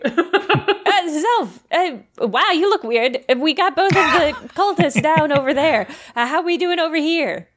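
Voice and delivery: chirpy news-anchor check-in; news update voice. Acted. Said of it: "give him a, a news update".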